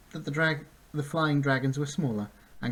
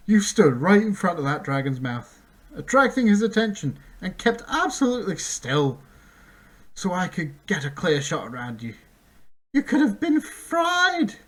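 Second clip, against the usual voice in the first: calling out; 7 semitones higher, 7 dB louder than usual. Acted. Centaur calling, praising.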